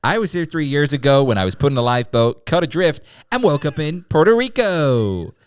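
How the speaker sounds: high, far-off put-on celebrity voice. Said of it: "It's me, [name]".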